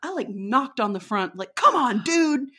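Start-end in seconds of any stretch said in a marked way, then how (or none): 1.6-2.5 annoyed. Retold or imitated. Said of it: "firmly and angrily".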